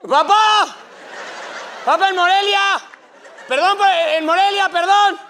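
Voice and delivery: grito agudo y fuerte; llama al Papa entre la multitud; grito desesperado. Acted. Gritándole al Papa en la plaza. (fan gritando para que el Papa lo oiga)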